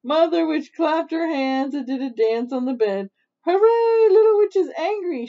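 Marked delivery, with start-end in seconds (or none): 3.5-5.3 high, loud gleeful hooray; jubilant cheer. A narrator's reading. Mother witch celebrates her daughter's anger, jubilant cheer. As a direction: cheer it out, pitched way up.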